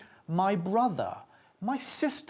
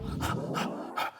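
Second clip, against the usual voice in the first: voiceless puffs of breath; short unvoiced huffs; breathy H sound; 5 dB quieter than usual. Shown as a demonstration. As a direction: demonstrate an airy H with bare breath. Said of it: "con un sonido".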